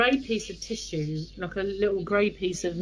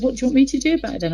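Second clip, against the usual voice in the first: retold exasperation, raised and rushed; quick, louder, higher-pitched protest; exasperated husband; 5 semitones higher, 7 dB louder, much faster than usual. Husband asked to treat his wife's sting, exasperated. Husband resists her plea for help.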